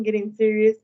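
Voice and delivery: usual speaking voice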